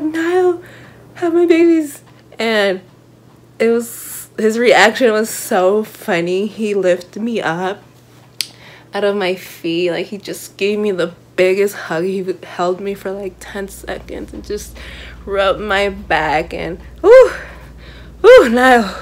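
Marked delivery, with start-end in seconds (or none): 0.0-2.0 excited blurt. Retold or imitated. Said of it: "I was like". 17.0-19.0 swooning. Real, not acted. Swooning over idol's hug.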